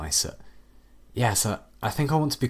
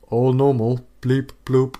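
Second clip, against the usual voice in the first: higher, louder mock robot chirp; fake robot voice; 5 semitones higher, 7 dB louder, slower than usual. AI faking robot voice for its creators. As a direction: play the dumb computer. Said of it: "rehearsed computer noises you're making".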